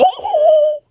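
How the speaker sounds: a very high falsetto "Oh!" of shock; shocked squeal. Retold or imitated. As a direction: squeal it like a scandalized skeleton. Brook reacts to bath scene.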